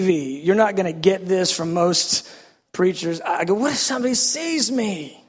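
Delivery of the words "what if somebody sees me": high-pitched worried whine; mock-anxious plea. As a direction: fret to God in a raised pitch. Repeats his worried plea to God.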